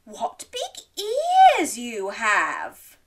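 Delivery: slow, wondering, starting high; said slowly. Acted. Little Red eyeing Granny's ears, slow wondering voice. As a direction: draw out the words in wonder. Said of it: "she said slowly".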